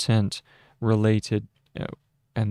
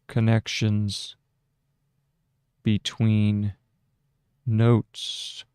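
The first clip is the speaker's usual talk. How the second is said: dictating; slower than usual. Shown as a demonstration.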